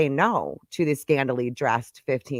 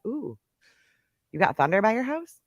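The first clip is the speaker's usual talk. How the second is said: normal talking voice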